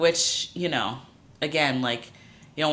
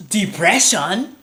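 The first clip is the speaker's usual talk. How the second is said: put-on high, slow questioning African accent; incredulous African accent; 7 semitones higher, 9 dB louder, slower than usual. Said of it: "my best impression of an African person".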